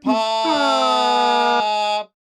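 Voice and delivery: drawn-out booming title call; announcer voice. Acted. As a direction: stretch the show name out big. Dramatic show title drop.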